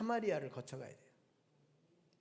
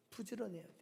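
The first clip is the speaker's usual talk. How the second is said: much softer, almost under the breath; a hushed second saying of 부지런해야 돼요; soft repeat; 11 dB quieter, much faster than usual. Shown as a demonstration. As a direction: drop the voice right down for the echo. Preacher repeats the exhortation, softly.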